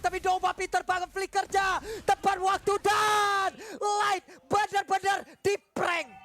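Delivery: hyped caster yelling the play; excited shout. Real, not acted. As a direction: shout it out, caught up in the moment. Casters hype a teamfight play. (teriakan caster yang heboh)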